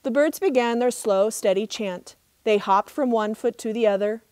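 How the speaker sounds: plain reading voice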